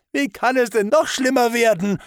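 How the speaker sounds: high, loud tearful cry; bitter, tearful and shrill outburst; crying hysterically. Acted. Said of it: "es weinte". (hoch, laut, weinend und hysterisch)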